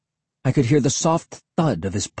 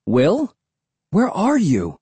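higher-pitched, worried call; anxious calling; 6 semitones higher, slower than usual. A narrator's reading. Kid separated from Will in the swamp, calling anxiously. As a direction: call out for a missing friend.

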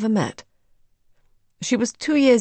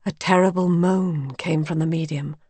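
ordinary delivery, nothing marked

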